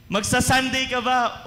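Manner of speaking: high, loud teasing question; mock quizzing. Preacher quizzing a churchgoer, loud and teasing. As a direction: ask it like checking up on a member.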